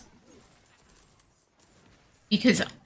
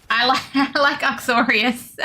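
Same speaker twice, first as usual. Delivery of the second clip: real laugh breaking into the words, higher; 5 semitones higher than usual. Real, not acted. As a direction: say it through a laugh, pitch lifted. Amused by chat's support of accent.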